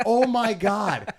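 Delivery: slow loud exaggerated dismay; mock horror. Telling friend about Disney crowds, slow mock-horrified. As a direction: drag it out in loud fake shock. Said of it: "And I'm like".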